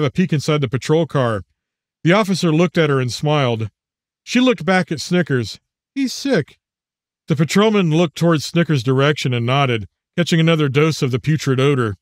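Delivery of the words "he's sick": put-on young girl voice. Little girl to officer.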